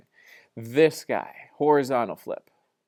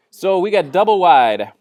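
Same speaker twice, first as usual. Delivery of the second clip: booming DOUBLE WIDE with a falling end; loud exclaim; 6 semitones higher, 14 dB louder than usual. Real, not acted. Teacher exclaims the term.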